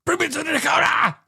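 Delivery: high, strained yell run together as one word; really loud shout. Retold or imitated. Host imitating the hero demanding Jakoda, shouted. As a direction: scream it all in one breath. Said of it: "one really loud sentence".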